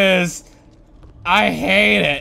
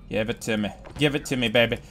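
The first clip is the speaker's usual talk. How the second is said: much lower, much softer coaxing; coaxing murmur; 11 semitones lower, 9 dB quieter, much faster than usual. Real, not acted. Gamer coaxing the monster toward the door. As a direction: coax it under your breath.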